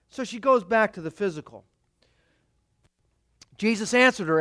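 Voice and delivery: ordinary talk, nothing marked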